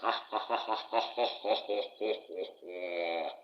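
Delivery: long, rolling put-on laugh; drawn-out theatrical laugh. Archangel Michael announces he is back alive, drawn-out stagey laugh. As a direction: laugh it out slowly, falling away at the end.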